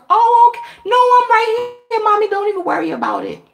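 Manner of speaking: sugary nurse voice. Retold or imitated. Sweetly reassuring patient's mother.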